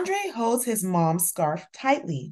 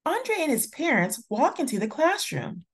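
usual speaking voice

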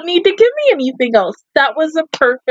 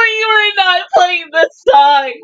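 tearful protest; 6 semitones higher, slower than usual. Real, not acted. Reacting tearfully to the song.

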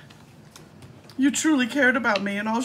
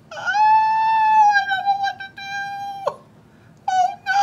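mock hysterical wail; 5 dB louder than usual. Fake despair at scammer.

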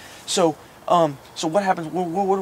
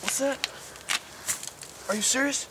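high excited urging; 8 semitones higher, 7 dB quieter than usual. Acted. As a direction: hurry everyone along, high and eager. Excited hunt, leprechaun spotted.

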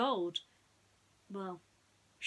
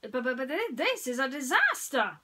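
panicked sheriff; 6 semitones higher, 9 dB louder than usual. Acted. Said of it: "cried the Sheriff".

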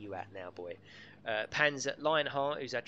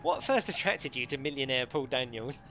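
mock chat show host; 3 semitones higher, 7 dB louder than usual. Quoting famous chat show question.

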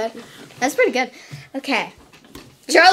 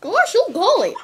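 higher, goofy cartoon voice; Goofy impression; 5 semitones higher than usual. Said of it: "impression of Goofy".

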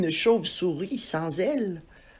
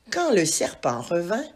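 ordinary delivery, nothing marked

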